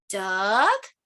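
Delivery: DUCK drawn out with a rising question tune. Acted. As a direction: ask "Duck?", sliding up at the end. Book guesses at each bird.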